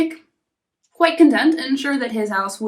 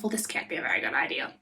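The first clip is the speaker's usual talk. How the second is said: low, soft, quick muttered thought; second pig privately doubts the wolf, low and hushed; quiet pig thought; 11 semitones lower, 10 dB quieter, faster than usual. Acted. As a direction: think it low and fast under the breath. Pig thinks to himself.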